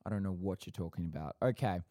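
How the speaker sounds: usual speaking voice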